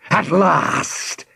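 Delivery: high-pitched villainous cry; triumphant villain shout. A narrator's reading. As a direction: cry out as a villain finally free. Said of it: "he shouted".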